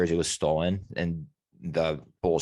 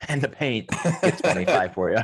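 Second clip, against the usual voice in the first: laughing; 4 semitones higher than usual. Real, not acted. Laughs mid-sentence at own joke.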